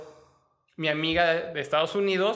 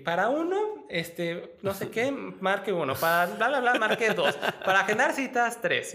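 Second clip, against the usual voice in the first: phone menu voice; 6 semitones higher than usual. Retold or imitated. Imitating the consulate phone menu.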